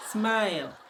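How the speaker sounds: "Smile" with a light laugh; smiling. Real, not acted. Telling viewers they may smile, laughing lightly. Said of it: "oh yeah, smile".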